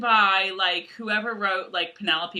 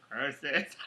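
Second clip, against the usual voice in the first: low, soft villain's curse; hammed-up defeat under the breath; foiled villain; 7 semitones lower, 8 dB quieter than usual. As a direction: play the thwarted melodrama villain.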